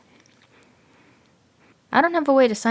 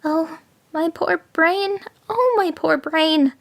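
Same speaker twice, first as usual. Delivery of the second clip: higher, slow, mock-despairing jackal; jackal's lament; 10 semitones higher, slower than usual. A narrator's reading. Jackal moans over his brain to fool the tiger, higher and slow. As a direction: wail it like a sly little jackal playing dumb. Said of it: "cried the jackal".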